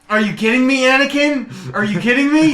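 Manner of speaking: high, loud comic disbelief; mock incredulous. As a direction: exclaim in exasperated disbelief. Mock disbelief at movie's boy.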